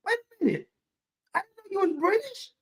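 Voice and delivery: hammed-up astonishment in a high voice; mock surprise. Reacting to Clever being British, mock surprised. Acts out surprise at her accent.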